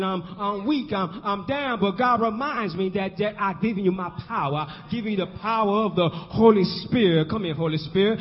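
usual speaking voice

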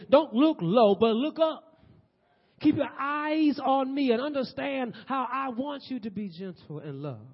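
loud, high preacher voicing God; impassioned divine urging. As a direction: proclaim it high and strong, easing at the end. God's urging voiced in sermon.

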